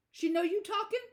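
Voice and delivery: high-pitched, rising alert question; bright, alert. A narrator's reading. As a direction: ask it sharply awake, voice climbing. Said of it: "suddenly bright alert".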